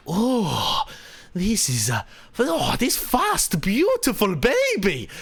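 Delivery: put-on high-toned, drawn-out Latvian-accented gushing; Miralyn's Latvian accent. Latvian guest admiring his host's car, high accented gushing. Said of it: "This is how he speaks, is, like, this high tone".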